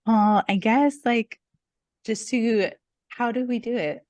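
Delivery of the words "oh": ordinary delivery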